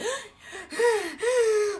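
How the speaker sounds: sharp high crying gasp; high sobbing gasp. Retold or imitated. Gasping sob, crying alone in the shower. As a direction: gasp like she's bawling in the shower. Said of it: "I'm like".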